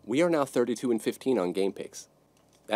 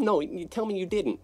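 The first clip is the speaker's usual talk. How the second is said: mock disbelief; 9 semitones higher than usual.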